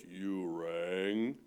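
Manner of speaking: very deep, slow butler's drawl; Lurch voice. Retold or imitated. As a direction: sink down into a gloomy butler's voice. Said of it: "this butler by the name of Lurch".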